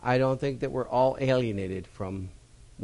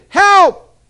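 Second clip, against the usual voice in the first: even higher, loud yell for help; cry for help; 19 dB louder than usual. Acted. Drowning man yelling for help again, loud cry. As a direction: shout it again, more desperate. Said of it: "cried again".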